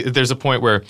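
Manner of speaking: normal talking voice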